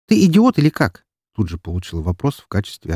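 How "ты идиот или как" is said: scornful snap. Acted. Snapping at him.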